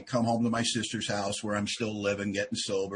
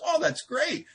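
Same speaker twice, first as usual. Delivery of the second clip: high, bright-eyed mock enthusiasm; sponsor's delighted reply; 13 semitones higher than usual. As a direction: jump way up for the sponsor's pleased reply. Sponsor voiced in retold talk.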